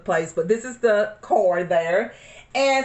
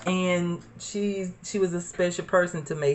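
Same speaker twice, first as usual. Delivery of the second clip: softer, fast, holding back tears; hushed and tender; 3 semitones lower, 6 dB quieter than usual. Real, not acted. Talking about her special mom, voice gone soft. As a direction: let it drop low as grief catches.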